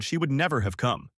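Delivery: plain reading voice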